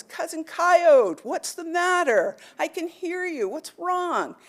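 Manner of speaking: slow booming call of a big buffalo. A narrator's reading. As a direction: boom it out like a giant buffalo. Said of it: "this booming voice says".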